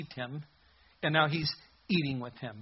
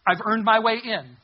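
entitled demand; 6 semitones higher, 8 dB louder than usual. Acted. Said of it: "the attitude must be".

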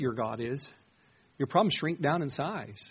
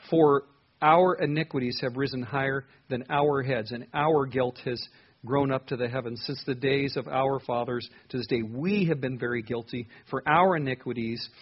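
"our" and "we" punched louder in a measured reading; stress on OUR and WE; 3 dB louder than usual. Shown as a demonstration. Preacher reading a leader's prayer to God, stressing "our" and "we". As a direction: lean hard on each "our" and "we". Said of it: "putting emphasis on the words".

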